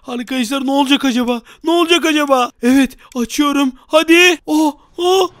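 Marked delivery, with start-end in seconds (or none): 4.5-5.3 higher, louder fearful yelp; scared gamer as the mirror opens; alarmed cry. Real, not acted. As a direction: yelp in shock.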